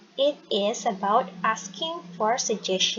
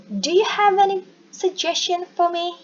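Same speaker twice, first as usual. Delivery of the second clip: higher, louder clear question; model reading; 7 semitones higher, 7 dB louder than usual. Shown as a demonstration. Teacher modelling a question for students to repeat. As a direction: read it out for the class to copy. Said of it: "one more time, listen and repeat".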